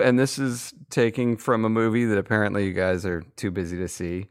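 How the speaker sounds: ordinary talk, nothing marked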